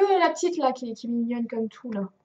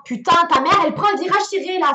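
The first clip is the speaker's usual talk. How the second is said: loud, higher-pitched ranting; exasperated outburst; 5 semitones higher, 8 dB louder, faster than usual. Real, not acted. Driver fighting a tight bend in game, exasperated. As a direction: blurt it in panicked exasperation.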